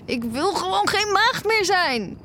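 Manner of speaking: high, blurted out in one go; embarrassed high blurt; blurted confession. Acted. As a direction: get it out before you lose nerve.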